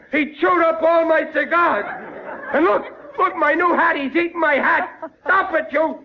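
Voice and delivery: high, rising outrage at the dog; flustered high-pitched scolding; upset yelling at dog. Acted. Riley's hat eaten by the dog.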